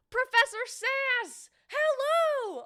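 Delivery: high, loud and drawn-out hello; excited greeting. Real, not acted. Streamer greets a friend arriving in chat, high and loud. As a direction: throw a big, delighted welcome at an arriving friend.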